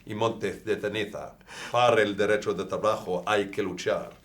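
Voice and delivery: put-on reciting Spanish phrases in a Spanish accent. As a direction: rattle off stock Spanish lines with an accent. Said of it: "You even say it with a Spanish accent".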